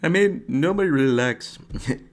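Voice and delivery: mock Canadian fighter twang, laughing at yourself; failed Canadian twang. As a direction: try a Canadian twang and crack up. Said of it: "I can't freaking do the Canadian".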